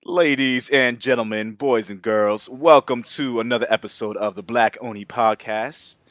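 grand entrance announcement. Acted. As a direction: announce it like a ringmaster. Host opens podcast episode.

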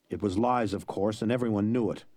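ordinary delivery

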